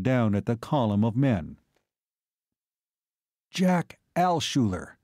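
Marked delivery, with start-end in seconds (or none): 3.5-5.0 slow, higher-pitched, mild surprise; name him slowly, with surprise; surprised. A narrator's reading. Pilot recognises the cavalry below, surprised. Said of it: "he whistled in surprise".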